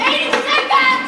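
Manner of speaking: slow, high, loud warning; shouted countdown. Acted. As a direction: yell out the time left.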